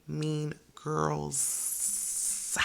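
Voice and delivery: put-on "girls" stretched out with a long hissing S; heavy final S. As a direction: lean hard on the S at the end of "girls". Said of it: "That is a heavy S on the end".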